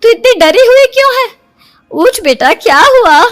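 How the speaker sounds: worried mother. Acted. Mother wakes her daughter from a nightmare, worried. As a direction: shake her awake with concern.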